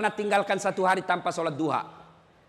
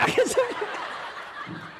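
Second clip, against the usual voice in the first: high breathy laugh; 12 semitones higher, 4 dB quieter than usual. Real, not acted. Reacting to the punchline.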